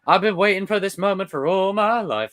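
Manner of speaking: high excited movie voice; excited character quote. Retold or imitated. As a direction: burst out like a thrilled hero. Acted quote, high and far.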